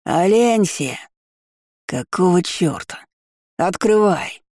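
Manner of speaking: bawling man's voice. A narrator's reading. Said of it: "горланил мужской голос".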